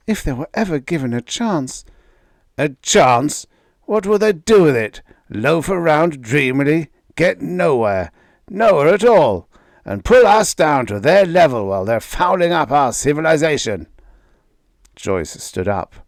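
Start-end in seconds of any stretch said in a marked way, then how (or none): 0.1-1.8 put-on higher, earnest young daughter; a daughter defending her fiancé, pitched higher; young woman's voice. A narrator's reading. Daughter defending Gregrill to her father, higher young voice. 2.6-13.9 rising, louder, furious father; a father raging against Martians; angry tirade. A narrator's reading. Father ranting at his daughter, loud and furious.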